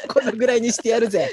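mock tough sign-off. Co-host twists the closing line, laughing mock swagger. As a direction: play the tough guy through laughter.

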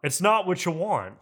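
usual speaking voice

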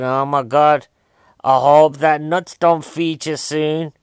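loud, drawn-out mock worry; mock dread. Film viewer fretting about the nut allergy, mock dread. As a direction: stretch it out as hammed-up foreboding.

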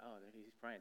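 very soft, low murmur; hushed disciples. Acted. Disciples finding Jesus at prayer, hushed. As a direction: keep it down so as not to interrupt. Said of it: "They just come down real quietly".

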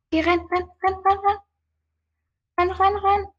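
high, urgent panicked urging; run, run, spoken high and frantic; being chased. Real, not acted. Fleeing an enemy in game.

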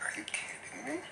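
breathless whispered "are you kidding me"; whispered disbelief. Retold or imitated. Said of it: "I was like".

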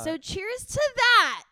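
slow, high, loud cheer; drawn-out toast. Real, not acted. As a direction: raise a glass, big and slow.

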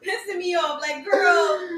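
high, loud, half-crying with laughter; worked-up laughing outburst. Real, not acted. Co-host reacting to Bia gossip, loud laughing outburst. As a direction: cry it out loudly, cracking up.

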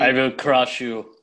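slow, menacing Russian boxer; lightly voiced Rocky IV villain. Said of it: "Rocky four moment".

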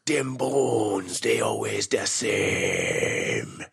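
slow, drawn-out cryptic Jamaican voice; stretched-out mock Jamaican voodoo line; Jamaican accent. Host parodying King Willie throwing bones, slow Jamaican accent.

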